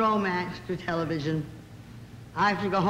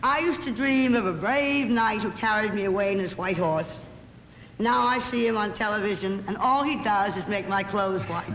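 ordinary talk, nothing marked

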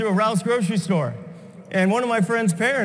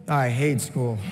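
low grumbling aside; 9 semitones lower, slower than usual. Real, not acted. Aside about going back.

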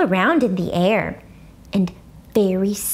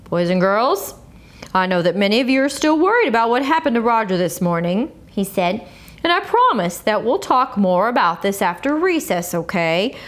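lightly voiced calm teacher; a hint of a patient teacher. A narrator's reading. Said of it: "real calm".

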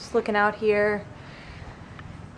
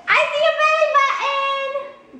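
much higher playful squeal; 3 dB louder than usual. Real, not acted. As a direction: squeal it to make her laugh. Playing with the baby.

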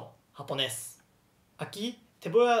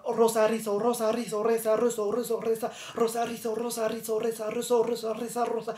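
higher, rapid-fire monotone recitation; tongue twister; 5 semitones higher, faster than usual. Shown as a demonstration. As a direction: race through the words without a break. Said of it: "hablar rápido".

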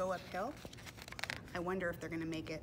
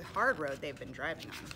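plain reading voice

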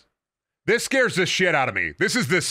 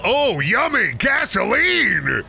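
hammy gourmet; 6 semitones higher, 3 dB louder, much slower than usual. Pretend. Jokes about eating fire barrel.

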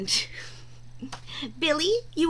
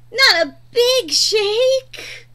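pleading; 6 semitones higher, 7 dB louder, much slower than usual. Acted. Dog about to shake.

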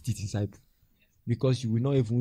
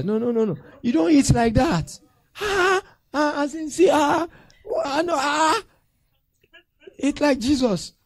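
high, loud, hammed-up reprimand; mock scolding; 9 dB louder than usual. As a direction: cry out in comic dismay, correcting a pupil. Acted scolding over table manners.

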